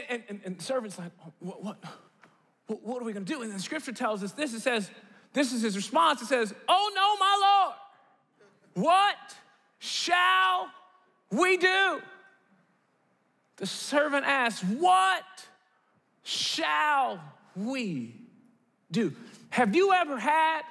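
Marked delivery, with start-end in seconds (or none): 1.2-3.4 hushed, stammering mock fear; panicked servant. Servant to Elisha, surrounded by an army, stammering in hushed fright. 6.7-12.0 high, drawn-out theatrical cry; dismayed servant. Acted. Servant to his lord before the army, hammed-up despair. Servant's response, acted with drama. 14.6-19.1 dramatic word-by-word question. Acted. Preacher repeats the servant's question, slow and dramatic.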